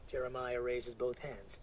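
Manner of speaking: usual speaking voice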